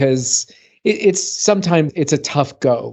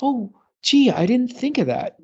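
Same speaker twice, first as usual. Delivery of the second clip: higher, lightly mocking gullible voice; mock the gullible reader, pitched up; naive email recipient; 9 semitones higher, 3 dB quieter than usual. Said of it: "people that get this email just".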